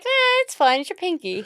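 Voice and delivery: high, offhand mocking voice; mock careless shrug. Co-host mocking her partner's shrug, high and offhand. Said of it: "But you're like".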